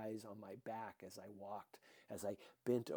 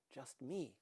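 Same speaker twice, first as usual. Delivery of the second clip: ordinary delivery, nothing marked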